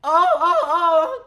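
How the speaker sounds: very high, loud swooning squeals; hammed-up shrieking teen impression; mock freak-out. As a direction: squeal like an overexcited fan. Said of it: "The sixteen-year-old freaks out".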